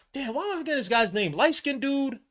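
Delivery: high-pitched frustration at a memory lapse; exasperated. Real, not acted. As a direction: blurt it out in exasperation. Frustrated at forgetting a player's name.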